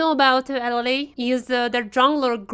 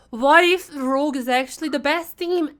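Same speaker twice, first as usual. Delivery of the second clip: normal talking voice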